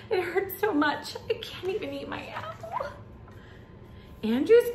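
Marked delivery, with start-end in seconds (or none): 0.1-2.7 put-on high little-boy voice, fading softer; hurt kid's high-pitched grumble; complaining child. A narrator's reading. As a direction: whine about a sore tooth, high and dwindling. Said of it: "took a bite and yelled".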